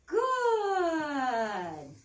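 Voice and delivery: high, loud "Good." to the dog; praise. Real, not acted. Trainer praising the dog, high and loud. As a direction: sing out the praise to reward the dog.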